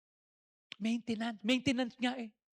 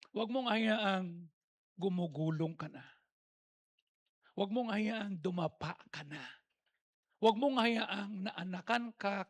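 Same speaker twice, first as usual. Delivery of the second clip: drawn-out, lower pleading; slow deliberate warning; 3 semitones lower, slower than usual. Acted. Preacher warning congregation slowly and solemnly. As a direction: linger on each warning.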